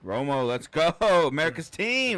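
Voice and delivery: loud, cheering chant; fan cheer. Pretend. Cowboys fan cheering for Romo, loud. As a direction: call it out like a fan in the stands.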